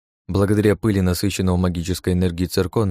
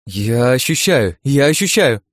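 higher, louder cry of discovery, rising on the repeat; excited exclamation; 5 semitones higher, 5 dB louder, slower than usual. A narrator's reading. Iren suddenly senses the glove's magic, excited exclamation. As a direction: stretch the first, build into the second. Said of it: "Вдруг воскликнула Ирен".

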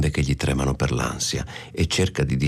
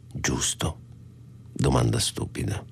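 whispered; 4 semitones lower, 6 dB quieter than usual. Acted. Tense secret talk with fugitive.

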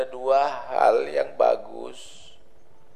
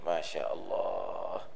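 much lower, soft and slow in awe; awed murmur; 11 dB quieter, slower than usual. Retold or imitated. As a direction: breathe it out, stunned by the revelation. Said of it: "Kata si wali".

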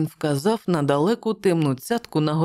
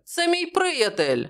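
calling out; 12 semitones higher than usual. A narrator's reading. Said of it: "гукнув Мелоун".

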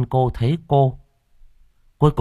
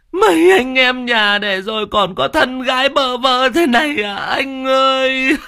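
fake wailing widow; 5 dB louder than usual. Cúc fakes grief for her dead brother, hammed-up wail. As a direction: overact the grief at the funeral. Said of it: "cố tình vật vã gào lên gào xuống".

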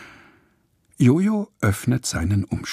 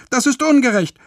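viel höher und lauter, beleidigt; empört; 5 dB louder than usual. Acted. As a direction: trotzig protestieren. Lotte empört über Ticket. (Lotte beschwert sich empört über die Ungerechtigkeit)

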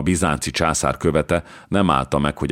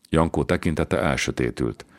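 ordinary delivery, nothing marked

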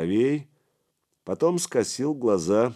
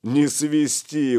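loud, slow, drawn-out scoff; mocking dismissal; 7 semitones higher, 5 dB louder, much slower than usual. A narrator's reading. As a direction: drag it out in amused disbelief. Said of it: "усмехнулся Гораст".

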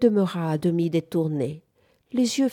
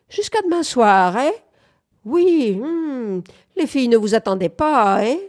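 put-on higher, louder old man's quizzing; teasing old man; 6 semitones higher, 6 dB louder than usual. A narrator's reading. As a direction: tease the young visitor in an old man's voice. Teasing old man questions the visitor.

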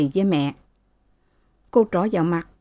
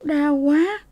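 high, pained moan; wincing in pain; 9 semitones higher than usual.

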